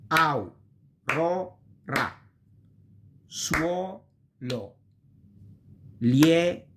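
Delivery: "Au, ro, ra", "Suolo", "Lieve" chanted slowly, syllable by syllable; school teacher drill. Shown as a demonstration. Teacher mimicking a school teacher drilling the class, slow and syllabic. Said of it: "like the school teacher used to do".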